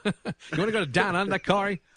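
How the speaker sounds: hammed-up eager pitch; mock eager invite. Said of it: "I wouldn't be like".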